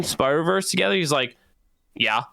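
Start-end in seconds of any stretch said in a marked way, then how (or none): none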